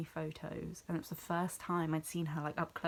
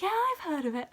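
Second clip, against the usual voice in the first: high, loud chirpy imitation of the star; imitating her reply; 13 semitones higher, 7 dB louder than usual. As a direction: play the star, bright and eager. Celebrity replies, imitated brightly.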